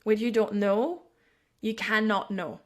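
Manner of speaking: ordinary talk, nothing marked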